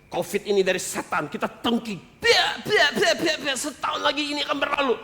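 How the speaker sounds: high, fast mock tongues babble; mimicking a senior pastor's babbling prayer; mocking a tongues-speaker. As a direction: parody a pastor rebuking covid. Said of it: "pendeta senior. Basaro".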